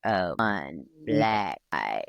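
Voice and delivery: low, slow spooky chant; ghost voice. Pretend. Listener joins the ghost line, slow and low. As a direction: drag it out deep like a ghost. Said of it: "say it with me".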